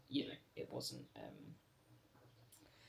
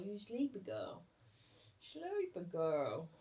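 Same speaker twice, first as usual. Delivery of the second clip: cooing to a pet; 3 semitones higher, slower than usual. Real, not acted. Cooing to the sleepy dog.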